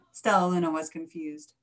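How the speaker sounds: usual speaking voice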